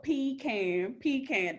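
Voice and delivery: "Pecan" as PEE-CAN, both parts clear; as spelled. Shown as a demonstration. Insisting on the spelling to friends, "PEE-CAN". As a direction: say "Pecan" with long ee, can as in tin can. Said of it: "exactly how it's spelled".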